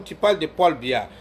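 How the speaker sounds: ordinary delivery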